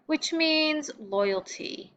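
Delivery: normal talking voice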